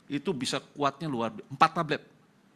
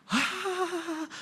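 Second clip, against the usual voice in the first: pained cry. Retold or imitated. Wife crying out in pain.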